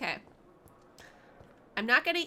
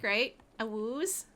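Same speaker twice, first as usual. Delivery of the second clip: normal talking voice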